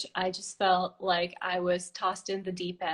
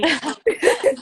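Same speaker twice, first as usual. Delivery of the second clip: a high, loud burst of laughter; a laugh that rises at the end; 10 semitones higher, 12 dB louder than usual. Real, not acted. Laughs at the beer joke.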